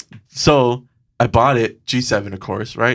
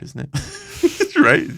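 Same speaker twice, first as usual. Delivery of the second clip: laughing squeak; much slower than usual. Retold or imitated. Awkward laughing aside.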